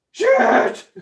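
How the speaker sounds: sudden high loud yelp; startled curse. Real, not acted. Sees his crucible crack, shocked cry. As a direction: blurt it out in shock.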